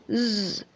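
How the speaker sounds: soft buzzing hum; bee buzz. A narrator's reading. Teacher doing the honeybee's buzz for the class. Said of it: "buzzed the honey bee".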